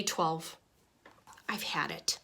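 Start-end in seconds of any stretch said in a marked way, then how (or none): 1.5-2.2 soft, defeated whisper; a worn-out fish muttering in a whisper; exhausted whisper. Acted. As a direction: let it out in a spent whisper. Fed-up fish at his limit.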